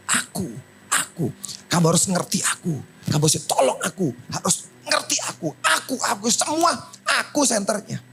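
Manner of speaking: mildly mocking a self-centred plea; lightly voiced selfish Hana. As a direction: whine that it's all about her.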